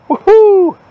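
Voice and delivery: high, gleeful victory whoop; excited whoop. Real, not acted. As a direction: cheer like you just struck gold. Celebrates another quarter found.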